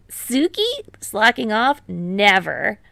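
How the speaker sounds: high, loud, hammed-up indignation; theatrical gasp of offense; mock offense. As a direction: play wounded pride for laughs. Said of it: "Tadashi mocks offense".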